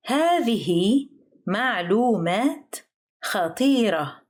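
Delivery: careful word-by-word teaching pace on "هذه معلومات خطيرة". Shown as a demonstration. Example phrase, slow teaching take.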